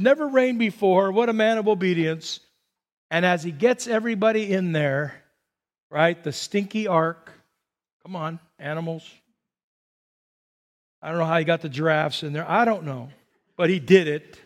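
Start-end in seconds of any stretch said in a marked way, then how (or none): none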